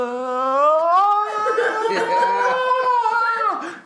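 loud, high howling laugh. Real, not acted. Man cracking up at his own story, loud and high. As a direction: burst out in a big, high cackle.

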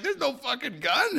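high, drawn-out mock protest; retold protest. Raided man denying it to cops, mock incredulous protest. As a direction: imitate a man insisting in disbelief. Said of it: "And he's like".

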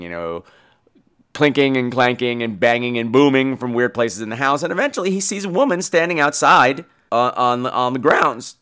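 ordinary delivery

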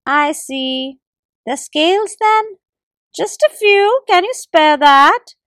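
high, slow begging squirrel; squirrel pleading. A narrator's reading. Squirrel begs the gecko for scales, high and slow. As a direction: beg for just a few scales. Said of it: "tried the squirrel again".

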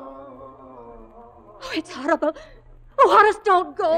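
fast frightened cry, rising to a plea; a wife begging her husband not to open the door, frightened; terrified pleading. Acted. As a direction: beg him not to go, near tears. Terrified wife after thud at door.

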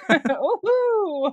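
high, playful whoop; excited cheer. Real, not acted. Guest greeting her new friends, excited whoop.

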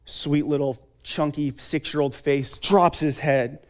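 normal talking voice

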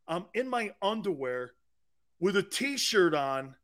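ordinary talk, nothing marked

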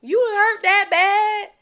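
high, slow disbelief; incredulous. Real, not acted. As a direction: ask in disbelief. Incredulous question.

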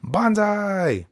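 high, put-on minion cheer; cheering henchmen. Henchmen hail Garlic Junior's eternal life, high mock cheer.

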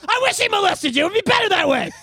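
high, loud fed-up outburst; mock exasperated mom. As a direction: bark it like a mom who's had enough. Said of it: "She's like".

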